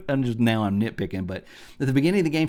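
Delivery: ordinary delivery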